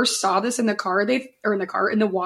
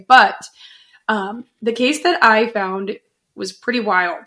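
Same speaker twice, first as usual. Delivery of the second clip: ordinary delivery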